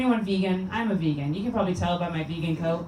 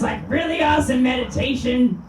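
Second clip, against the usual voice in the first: usual speaking voice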